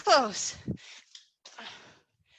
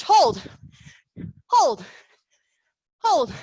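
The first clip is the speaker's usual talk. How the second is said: urging shout; 5 dB louder, much slower than usual. Real, not acted. Coach urging hold during exercise.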